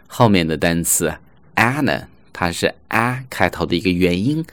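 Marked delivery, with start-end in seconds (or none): none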